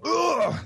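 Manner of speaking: sharp high grunt of a soldier getting hit; short video game pain grunt; hit-reaction grunt from a shooter. Shown as a demonstration. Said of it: "they need people to go".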